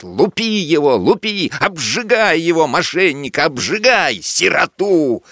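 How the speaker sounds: high, ringing yells between laughs; officer cackling out orders, pitched high; gleeful shouting. A narrator's reading. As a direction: egg the soldiers on with cruel delight. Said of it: "перервётся его звонкий, здоровый, раскатистый смех".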